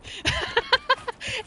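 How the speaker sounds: squeaky burst of laughter; high breathy giggle. Real, not acted. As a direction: laugh at your own French toast joke. Laughing at own joke.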